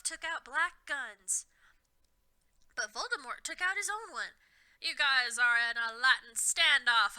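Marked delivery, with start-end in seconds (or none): 4.8-7.2 loud, slow exasperated shout; despairing shout. A narrator's reading. Ebony at the standoff, loud slow despair. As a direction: shout it like you've given up. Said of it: "I shouted despairedly".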